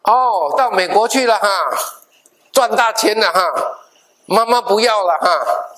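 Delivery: a mother's bitter reproach; displeased mother. Retold or imitated. Dead mother scolding her son in his dream, sarcastic. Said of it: "我妈妈很不高兴的".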